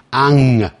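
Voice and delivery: "on" said as "ong"; long "ong" for "on"; ng ending. Shown as a demonstration. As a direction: let "on" ring into a back ng. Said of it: "有N G才会念成".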